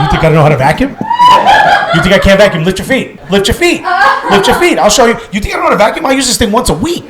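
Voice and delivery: offended outburst, building. Retold or imitated. Husband yelling at his wife over the vacuum, building. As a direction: build from hurt pride to a full rant.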